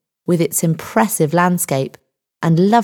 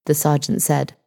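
usual speaking voice

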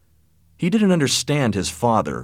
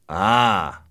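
sharply. A narrator's reading. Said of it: "said Anthony sharply".

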